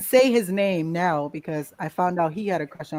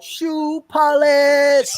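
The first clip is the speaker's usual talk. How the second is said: high, loud, drawn-out yell of "Shoe polish"; loud, high mocking shout, stretched slow; yelled taunt; 8 semitones higher, 7 dB louder, much slower than usual. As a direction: yell the nickname right in her face. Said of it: "and she yelled out".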